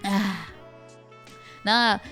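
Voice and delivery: normal talking voice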